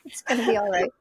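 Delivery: reassuring. Retold or imitated. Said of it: "And I'm like".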